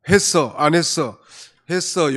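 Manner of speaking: loud, clipped, rude-sounding answers; loud, curt replies with no polite ending; curt blunt reply. Shown as a demonstration. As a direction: bark the short answers without politeness. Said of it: "요자 하나 뒤에 붙이면 참 좋겠는데".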